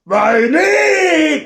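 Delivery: imitating his own high yell of Войны; high shout of Войны; shouting along. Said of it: "and then me going".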